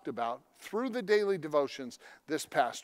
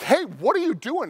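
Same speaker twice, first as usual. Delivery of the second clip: much higher and louder challenge; confronting shout; 9 semitones higher, 6 dB louder than usual. Retold or imitated. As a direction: scare off the stranger at the car. Yelling at stranger by car.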